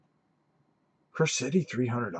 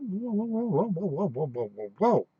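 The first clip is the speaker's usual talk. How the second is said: mock alarm; 8 semitones higher than usual. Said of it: "and I went".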